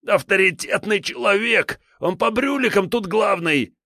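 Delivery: high whiny frightened thug; a cornered petty crook pleading; scared thug voice. A narrator's reading.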